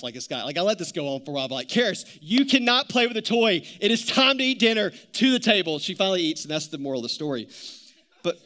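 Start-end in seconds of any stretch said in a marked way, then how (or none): 1.7-5.8 louder, higher exasperated order; retold loud order from a dad at the end of his rope; fed-up dad. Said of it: "finally, I just had enough".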